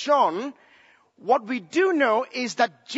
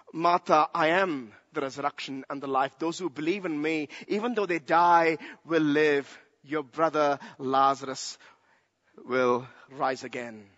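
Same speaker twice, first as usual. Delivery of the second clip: gentle answer; 7 semitones lower than usual. Acted. Jesus comforting grieving Martha, low and tender.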